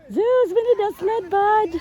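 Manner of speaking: high, bright call to the dog; cheery dog talk. Real, not acted. Talking to the dog in the snow.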